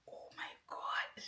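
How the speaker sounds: whispered, slow, drawn-out disbelief; chuchotement stupéfait. Retold or imitated. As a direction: whisper it slowly, imitating a partner's awe. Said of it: "You know the face? ... Not like".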